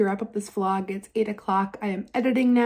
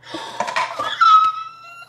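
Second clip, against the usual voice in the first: very high squealing gasp; delighted gasp; 4 dB louder than usual. Real, not acted. Opening a treasured gift from her bestie, delighted gasp.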